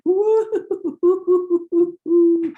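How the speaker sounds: high, loud warbling woo-hoo for rattling pots; wobbling pottery noise. Retold or imitated. Teller voicing pottery wobbling in the display case, high loud warble. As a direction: sing out the wobble of the teetering pottery.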